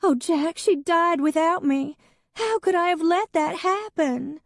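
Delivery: an ex wailing to Jack about her cat; weeping. A narrator's reading. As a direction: wail through the tears. Hadley keeps weeping to Jack.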